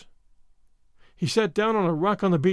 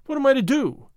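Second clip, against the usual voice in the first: higher-pitched exasperated question; despairing; 6 semitones higher, 4 dB louder than usual. A narrator's reading. Gordon, pacing with no news to send, despairing. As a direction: throw it out in mild despair. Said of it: "pacing up and down in despair".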